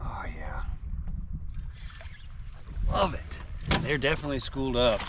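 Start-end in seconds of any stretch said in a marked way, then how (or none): 0.0-3.4 breathy whispered oh yeah; fishing whisper. Real, not acted. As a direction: hush it, almost no voice. Quiet delight landing a fish.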